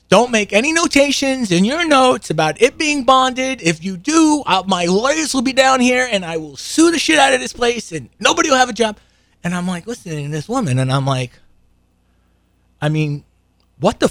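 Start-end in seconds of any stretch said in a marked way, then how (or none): 0.1-9.0 screaming threat. Retold or imitated. Karen screams at the dentist over the notes, imitated yell. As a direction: hammer out the lawsuit threat like a tantrum. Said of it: "And Karen screams at her".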